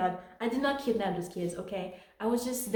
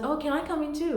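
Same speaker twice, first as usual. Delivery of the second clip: higher-pitched hint of a young boy; lightly voiced little brother; 7 semitones higher than usual. Younger brother asking to join his sister, lightly voiced child. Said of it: "her younger brother came in".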